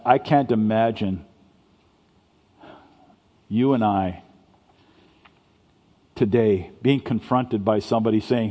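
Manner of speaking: normal talking voice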